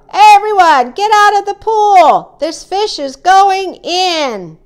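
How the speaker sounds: high, loud crowd-clearing call; yelled warning. A narrator's reading. Firemen to swimmers as the fish arrives, yelling. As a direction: yell to clear everyone out. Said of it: "The firemen yelled".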